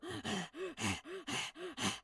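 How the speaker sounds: sharp inhaled pant, mimicking the dog; imitated dog gasp. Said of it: "and go".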